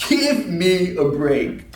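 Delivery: slow, drawn-out exasperation; fed-up comic groaning delivery; exasperated. Acted. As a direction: stretch out every word in disbelief. Exasperated reaction to selfie takers.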